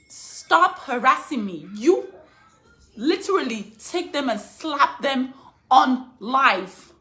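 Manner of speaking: loud, slow, furious words; angry demand. Real, not acted. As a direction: hammer each word out in anger. Angry mother confronting harassers.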